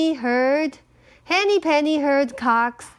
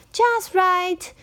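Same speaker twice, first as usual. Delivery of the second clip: put-on higher, satisfied child voice; pleased girl; 7 semitones higher than usual. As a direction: sigh of approval. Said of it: "she said".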